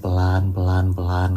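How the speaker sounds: soft, low, drawn-out repetition; slow it down softly like a door creeping open; hushed suspense. Retold or imitated. Door creeps open in ghost story. (pelan dan rendah, penuh ketegangan)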